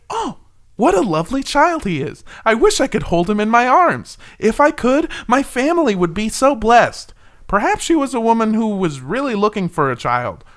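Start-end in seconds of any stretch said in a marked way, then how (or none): 0.1-7.1 high, cooing admiring lady voice. Retold or imitated. Retelling a lady gushing over a baby, high and cooing. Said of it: "One lady said".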